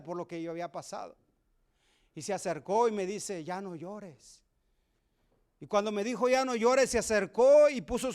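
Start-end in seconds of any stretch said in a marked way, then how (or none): 3.4-4.4 más suave y lento, con ternura; consuelo tierno. Retold or imitated. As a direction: como quien consuela a un niño que llora. Jesus comforts the crying man. (Jesús consuela al hombre que llora, suave y lento)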